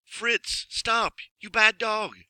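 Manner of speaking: high calling shout; crying out. Acted. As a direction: shout after the runaway dog. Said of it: "cried Alan".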